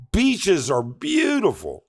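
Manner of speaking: normal talking voice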